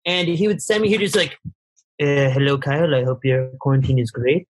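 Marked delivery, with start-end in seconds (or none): 2.0-4.5 put-on low, halting French Tunisian accent. Designer leaving the host a voice memo in quarantine, French Tunisian accent. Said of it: "I don't know what that accent is".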